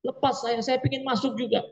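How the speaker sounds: a preacher imitating a mourner at the grave, pleading high; mock hysterical plea. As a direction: play a grieving mourner who begs to be let go, up high. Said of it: "Dia mengatakan".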